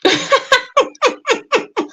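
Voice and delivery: long, high laugh in pulses; real giggling laugh, pitched high. Real, not acted. Reacting to a chat comment.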